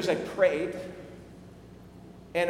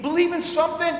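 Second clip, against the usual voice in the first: emphatic stranger; 10 semitones higher, 6 dB louder than usual. Retold or imitated. Stranger voiced.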